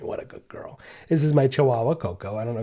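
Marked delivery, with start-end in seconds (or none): 0.0-0.8 soft whisper to a sleeping dog; whispered praise. Real, not acted. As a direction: breathe it so the dog stays asleep. Dog sleeping behind him.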